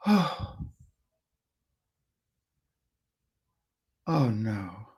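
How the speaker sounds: soft, low, hushed oh no; best-friend sympathy. Shown as a demonstration. Friend to someone grieving, softly heartfelt. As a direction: let the sorrow sink in, very quietly. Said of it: "feels like you've got a best friend".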